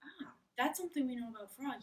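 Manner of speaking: ordinary delivery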